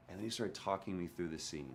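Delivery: normal talking voice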